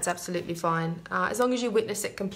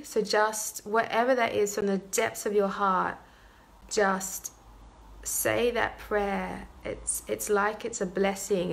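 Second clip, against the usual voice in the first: guided meditation. Acted. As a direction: ease them gently into the prayer.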